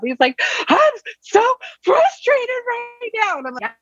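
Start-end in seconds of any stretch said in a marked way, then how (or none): 0.7-3.4 much higher and slow, a hammed-up upset little boy; frustrated kid voice. Mother imitating her son stuck on the dish rack, high slow whine. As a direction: stretch it out like a kid at the end of his rope.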